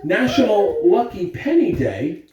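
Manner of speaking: bright, loud school PA announcement; announcer voice. Acted. Principal greeting the school on the morning announcements, loud announcer voice. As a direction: greet the whole school over the intercom.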